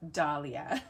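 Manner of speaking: Dahlia said DAH-lee-uh. Shown as a demonstration. Host reasoning from her name, Dahlia as "DAH-lia". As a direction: say Dahlia with the open "ah" of Zara. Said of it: "I'm just assuming that it's".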